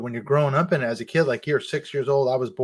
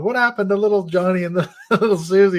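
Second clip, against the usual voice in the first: higher, louder laughing impression of a frantic parent; mock panicked parents; 6 semitones higher, 4 dB louder than usual. Parents freaking out over their lost kids, laughing mock panic. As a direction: ham up a fretting parent, half laughing. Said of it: "their parents are freaking out going".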